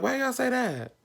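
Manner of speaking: asking why, surprised; puzzled reply to friends; high puzzled question. Retold or imitated. Own quoted reply.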